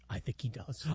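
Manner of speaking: very soft, low whisper; secretive murmur; hushed podcast voice. Pretend. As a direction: barely voice it, playing along.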